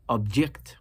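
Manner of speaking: "object" with JECT stressed, as the verb; stress on second syllable. Shown as a demonstration. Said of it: "placed my nabr on the second syllable".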